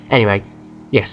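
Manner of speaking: ordinary talk, nothing marked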